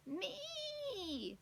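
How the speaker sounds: a mild owl screech of one word. A narrator's reading. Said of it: "screeched the old, the owl again".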